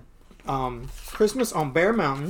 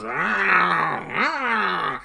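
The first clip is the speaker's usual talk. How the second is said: drawn-out mock bear roar; 3 semitones higher than usual. Mama bear bursting in, long mock roar. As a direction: roar like an angry cartoon bear.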